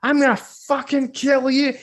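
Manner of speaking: high, loud, drawn-out threat; furious acted roar, far above normal pitch; enraged dwarf. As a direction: explode in fury as the brawler. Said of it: "he starts to lose his fucking mind".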